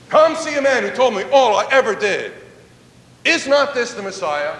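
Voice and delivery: loud, high call to the villagers; a woman running back into town, calling out excitedly. Acted. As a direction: shout the news to the whole village. Woman runs shouting to village.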